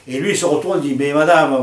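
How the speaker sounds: ordinary talk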